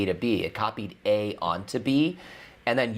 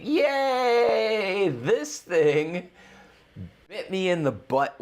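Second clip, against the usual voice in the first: excited; 8 semitones higher, much slower than usual. Real, not acted. Excited game reaction.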